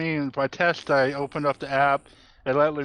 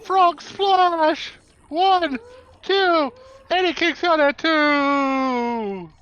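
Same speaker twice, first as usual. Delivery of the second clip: high, loud count, slow at the end; wrestling commentator; 10 semitones higher, 4 dB louder, much slower than usual. Pretend. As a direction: call the pin count like an announcer. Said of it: "Jim Ross".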